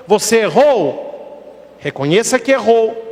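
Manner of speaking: normal talking voice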